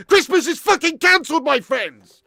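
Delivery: loud, high outburst easing off at the end; angry declaration. Real, not acted. Streamer announcing to viewers Christmas is off, loud angry rant. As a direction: declare it loudly, coming down from a shout.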